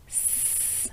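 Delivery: hissed S, tongue kept inside; soft clean hiss; model S sound. Shown as a demonstration. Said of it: "Do you see my tongue".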